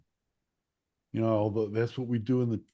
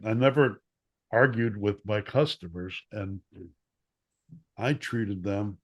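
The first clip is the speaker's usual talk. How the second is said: ordinary talk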